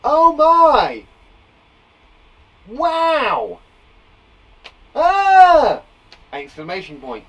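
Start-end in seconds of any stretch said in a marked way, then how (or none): 0.0-5.8 loud, high exclamations of surprise; show surprise. Shown as a demonstration. Teacher showing how exclamation marks show surprise, big surprised cries. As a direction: burst out in mock amazement. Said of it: "show surprise".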